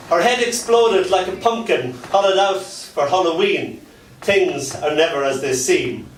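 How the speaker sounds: rhythmic spoken-word delivery; performance poem. Acted. Poet reciting dark fairy tale to a crowd.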